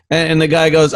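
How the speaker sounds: usual speaking voice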